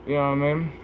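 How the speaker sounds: normal talking voice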